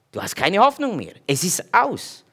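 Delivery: ordinary talk, nothing marked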